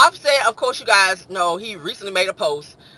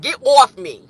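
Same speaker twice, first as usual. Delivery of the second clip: loud, higher New York rebuff; New York accent; 5 semitones higher, 8 dB louder than usual. Acted. Teasing New Yorkers in the chat, loud New York accent. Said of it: "Don't y'all talk like that".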